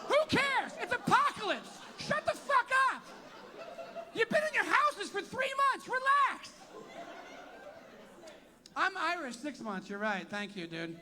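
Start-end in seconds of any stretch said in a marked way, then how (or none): 0.1-6.5 mock the crowd's attitude. Comic mocking the uptight crowd in a high put-on voice.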